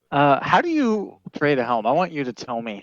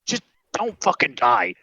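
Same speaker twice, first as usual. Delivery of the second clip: gruff god voice; 8 semitones higher than usual. Acted. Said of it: "he's like".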